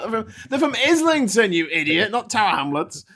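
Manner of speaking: high, loud mock London accent. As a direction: mimic an indignant London accent. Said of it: "they'll do the accent".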